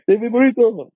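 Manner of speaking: calling the name high like a counter worker; mock order call-out. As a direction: sing the order name out across the shop.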